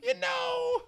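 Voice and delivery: very high yelped appeal; emphatic shout. Real, not acted. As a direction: yelp it at the top of your range. Mock-ranting about Cruella's name.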